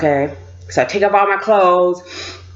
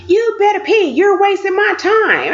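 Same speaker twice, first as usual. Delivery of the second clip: impatient officer; 10 semitones higher than usual. Retold or imitated. Officer telling her to pee, impatient scolding. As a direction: play the officer losing patience, pitched up.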